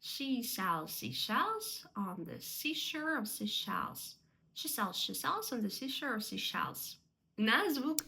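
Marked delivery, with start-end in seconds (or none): 0.0-4.2 slow, careful tongue twister; measured, deliberate recitation; slow and correct. Shown as a demonstration. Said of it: "треба спочатку повільно, правильно". 4.5-7.0 quicker tongue-twister run; brisk, run-together recitation; sped up. Shown as a demonstration. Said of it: "потім пришвидшуватися".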